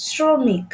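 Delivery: শ্রমিক opening on a hissed s, not sh; dental s. Shown as a demonstration. Teacher demonstrates the exception, শ্রমিক with dental s. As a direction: say শ্রমিক as "sromik". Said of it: "স এর মতো শ্রমিক".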